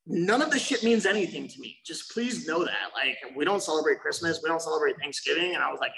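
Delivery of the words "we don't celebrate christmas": ordinary talk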